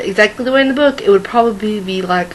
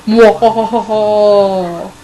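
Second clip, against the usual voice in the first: drawn-out villain laugh; slow evil cackle; 2 semitones lower, 4 dB louder, much slower than usual. Acted.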